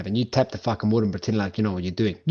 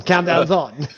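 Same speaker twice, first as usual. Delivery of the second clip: laughing repeat; 6 semitones higher, 5 dB louder, slower than usual. Real, not acted.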